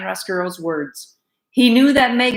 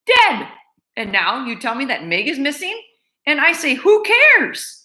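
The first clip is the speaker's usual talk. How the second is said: scornful housekeeper; 7 semitones higher than usual. Acted. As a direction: dismiss the girl with contempt. Louise scornful, raised voice.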